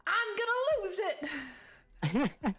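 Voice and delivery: very high, loud losing-it yell; frantic shout. Acted. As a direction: break free screaming, out of control. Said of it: "breaks away from Tretsky and says".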